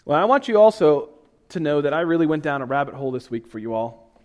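ordinary delivery

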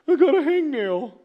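high, loud whine breaking into a laugh; melodramatic. Retold or imitated. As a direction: laugh through the fake tragedy. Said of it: "my mom used to call me melodramatic".

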